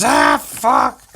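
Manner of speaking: loud, high mock shriek; screaming ape. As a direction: yelp in pain as a startled ape. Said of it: "this one goes".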